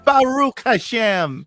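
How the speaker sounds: mock Midwestern Hebrew, laughing; slow, high, laughing Baruch Hashem; Midwestern accent. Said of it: "I sounded Jamaican".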